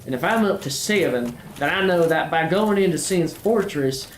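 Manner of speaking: a country-boy Southern accent played for laughs; put-on Southern accent. Gamer chatting to viewers in a mild mock Southern accent. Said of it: "I feel like I should be offended by this accent".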